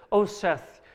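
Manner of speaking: high, loud imitation of a chiding producer; exclaimed, tut-tutting reproach; mock reproach. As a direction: playfully quote someone scolding you. Producer chides his coarse description.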